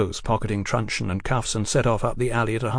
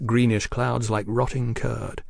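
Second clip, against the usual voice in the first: plain reading voice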